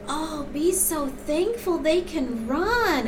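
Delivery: slow, high, lightly voiced old grandma; drawn out like a sweet grandmother's counsel; grandma's gentle advice. Grandma gently telling a tired young mom to be thankful. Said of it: "And she says".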